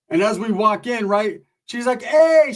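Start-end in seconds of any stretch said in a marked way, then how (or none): 2.0-2.5 loud, high, delighted "hey" in imitation; festive, happy greeting. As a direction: squeal a big mock-happy welcome. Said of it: "she's in the most festive, happy mood".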